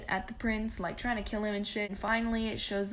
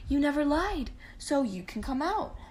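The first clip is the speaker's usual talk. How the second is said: higher, louder royal pronouncement; proclaiming king; 6 semitones higher, 3 dB louder than usual. Retold or imitated. The dad tells Rapunzel she can come out, higher and grand. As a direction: announce it grandly.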